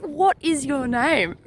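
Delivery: slow, deliberate, each word weighed; slow firm demand to a stubborn ghost; slow stern question. Retold or imitated. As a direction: spell it out slowly like a warning. Asking again, slower, sterner.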